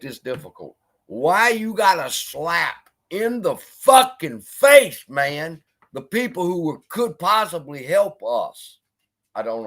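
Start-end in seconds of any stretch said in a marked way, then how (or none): 1.1-5.1 loud, slow, higher outraged demand; fired up. Real, not acted. To the streamer who insulted the judge, loud slow outrage. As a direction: lean in hard and drag it out, furious. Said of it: "Now I done got fired up".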